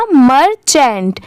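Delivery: मर्चेंट drawn out as MUR-chent with a falling end; slow model word. Shown as a demonstration. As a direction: blend the two parts of मर्चेंट slowly for learners. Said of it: "दोनों मिला देंगे तो क्या हो जाएगा". (मर्चेंट को धीरे और साफ़ जोड़कर बोलें)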